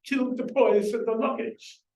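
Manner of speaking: lightly voiced Welsh soldier quoting "killed the boys at the luggage"; Welsh accent. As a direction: quote the captain in a mild Welsh lilt. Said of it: "as Llewellyn says in his Welsh accent".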